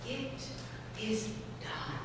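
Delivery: very soft, hushed reassurance; soft magic fish. Acted. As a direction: breathe it like a gentle spell. Fish comforts the fisherman.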